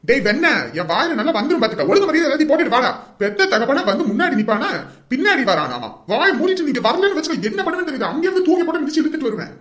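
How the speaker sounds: high, heated scolding; elder brother losing his temper; angry threatening. Acted. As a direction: threaten him into coming at once. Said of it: "அண்ணன் ரொம்ப திட்டுவாரு".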